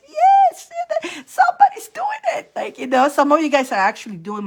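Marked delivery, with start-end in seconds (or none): 0.1-2.5 much higher, louder cry of joy; cheering on a viewer who got there first, much higher and louder; thrilled cheer. Retold or imitated. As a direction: squeal it like a proud fan. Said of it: "I'm like".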